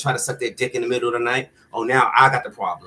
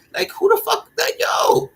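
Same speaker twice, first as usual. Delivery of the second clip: outraged shout. Real, not acted. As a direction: shout it out in disbelief. Outraged exclamation, shout heard.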